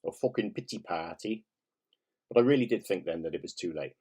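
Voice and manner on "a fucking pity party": imitating a friend's strong Welsh accent; quoted line in a mock Welsh accent; deep Welsh accent. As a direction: put on a Welsh voice, pitched slightly up. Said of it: "in her splendidly deep Welsh accent".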